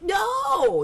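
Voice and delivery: high, loud barked no; mother shouting no at the Spanish-speaking child, loud; sharp shout of no. Retold or imitated. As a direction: yell no at the child like the strict mother.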